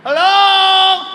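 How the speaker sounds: high, loud long hello; drunk customer calling. Retold or imitated. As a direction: call up at the window.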